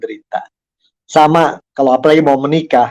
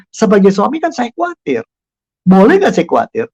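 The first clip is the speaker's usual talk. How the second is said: higher, mock-worried plea to his wife; husband voicing worry to his risk-taking wife, raised and pleading; 6 semitones higher than usual. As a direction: play the fretting husband asking permission to worry.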